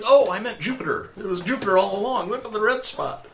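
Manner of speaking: high, breezy cover-up; high-pitched correction. Acted. Dream guide covers the moon mistake, high-pitched correction. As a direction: toss it off high, quickly correcting yourself.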